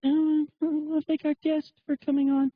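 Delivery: high put-on woman's voice; mocking female radio host. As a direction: imitate a woman host thanking her guest up high. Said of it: "It's like".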